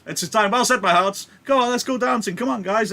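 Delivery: high, loud party cheer; rally the guests, loud and jolly; loud hearty host. Acted. Capulet turning to his party guests, loud and hearty.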